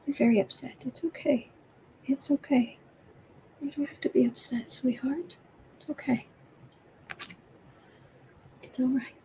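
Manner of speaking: hushed, tender reassurance; soft whispered comfort. Real, not acted. Owner hushes her upset cat, tender whisper. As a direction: whisper it's okay to a pet.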